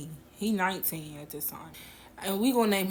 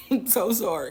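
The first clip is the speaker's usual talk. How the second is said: laughing apology; 2 semitones higher, 4 dB louder than usual. Real, not acted. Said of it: "I don't know why I just laugh like that".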